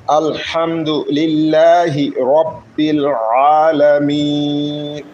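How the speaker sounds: আলামিন with its final vowel held for three counts; three-count stretch. Shown as a demonstration. Teacher demonstrating the three-alif stretch on আলামিন to students. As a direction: hold the long a of আলামিন for three beats. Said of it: "তিন এলিফ টান দিচ্ছি".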